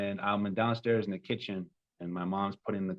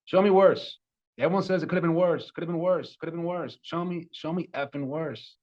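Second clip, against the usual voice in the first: high, loud and fast, then fading; a furious cry that loses its force; retold furious yell; 9 semitones higher, 4 dB louder than usual. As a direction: rage at God, begging for worse. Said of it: "And I was yelling clearly".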